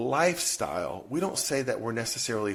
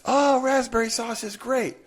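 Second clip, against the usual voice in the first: put-on eager kid; 12 semitones higher, 4 dB louder than usual. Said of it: "And the kids".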